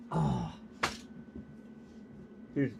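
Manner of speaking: ordinary talk, nothing marked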